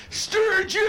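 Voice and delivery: high, loud joyful yell of the town name; gleeful shout. Retold or imitated. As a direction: shout it out like a happy biker.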